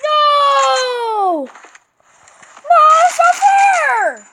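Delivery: high, loud cries of protest; outraged wailing shout. Real, not acted. Player protesting an unfair death, outraged high shout. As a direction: wail it out like a cheated player.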